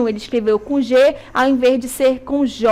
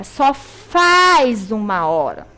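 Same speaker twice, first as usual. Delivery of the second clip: "só faz uma hora" said slowly, leaning on "faz"; slow teacher model "só faz uma hora"; slow corrected phrase; 2 semitones lower, much slower than usual. Shown as a demonstration. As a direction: model the right form word by word. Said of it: "Não é fazem uma hora".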